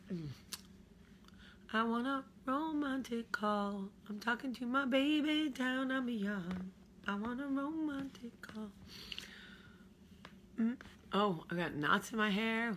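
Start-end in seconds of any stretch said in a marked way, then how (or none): 1.7-8.7 romantic call. Pretend. Silly high voice.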